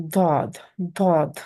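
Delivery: heavy, reverberant D on "dod"; heavy D sound. Shown as a demonstration. Teacher demonstrates after explaining tongue position, heavy D. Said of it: "You get kind of a reverb".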